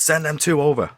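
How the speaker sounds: imitated coach's order, pitched high; lightly strained calling-out voice; mock summons across the pitch; Bobby Saxton. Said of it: "his voice, this was his voice".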